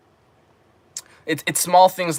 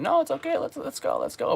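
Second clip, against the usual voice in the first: high, soft persuading falsetto; hammed-up coaxing; coaxing mock voice; 14 semitones higher, 6 dB quieter than usual. Imitating a guy coaxing a girl onward, high and soft. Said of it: "keep saying".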